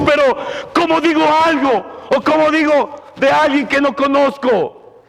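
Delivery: urgent preacher, pitch up and pushing hard; impassioned preaching. Acted. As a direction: preach it like a lost soul is on you. Preacher builds to full force.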